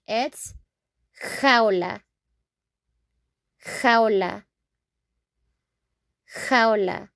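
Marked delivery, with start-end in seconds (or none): 1.4-7.1 "jaula" as HOW-lah, throaty h; Spanish pronunciation. Shown as a demonstration. Teacher modelling Spanish for cage, "jaula" as throaty HOW-lah. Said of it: "the meaning in Spanish".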